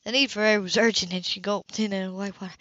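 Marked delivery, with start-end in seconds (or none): none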